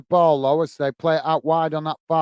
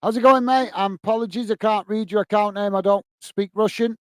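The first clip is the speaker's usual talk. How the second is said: usual speaking voice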